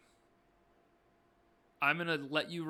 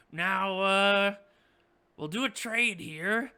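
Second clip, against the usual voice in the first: higher, louder goblin voice, drawn out; goblin boss; 4 semitones higher, 7 dB louder, slower than usual. Acted. Goblin boss proposing a prisoner trade, slow and loud. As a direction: lay out the deal slowly and loudly. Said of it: "And Yimmick goes".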